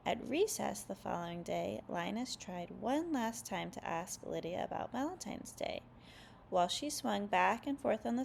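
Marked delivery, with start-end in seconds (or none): none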